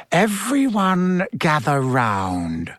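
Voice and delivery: slow, drawn-out summons; commanding teacher. Acted. Snape gathers pupils round the cauldron, commanding. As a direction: summon the class slowly and deliberately.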